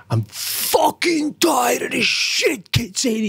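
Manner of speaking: put-on high, slow exasperated snap at the kid; mock angry mom. Mother snapping at her annoying daughter, mock angry. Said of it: "Jackie turns around and goes".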